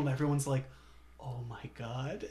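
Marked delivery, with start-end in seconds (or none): 1.2-2.2 slow, soft mock awe; moved onlooker. Crowd moved by the emotional speech, mock awed. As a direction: imitate a hushed, overwhelmed crowd. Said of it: "it's so emotional".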